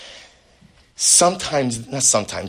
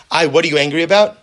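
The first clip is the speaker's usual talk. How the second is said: higher, louder, quick baffled question; an exasperated friend asking, louder; puzzled questioner; 5 semitones higher, 7 dB louder, faster than usual. Acted. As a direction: ask why they're still mad. Acted adult quarrel.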